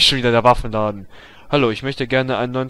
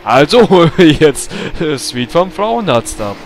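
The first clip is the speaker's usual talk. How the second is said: loud; 4 semitones higher, 8 dB louder than usual. Acted. Repeated louder, slower.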